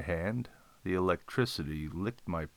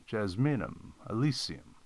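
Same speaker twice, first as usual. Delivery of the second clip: ordinary delivery, nothing marked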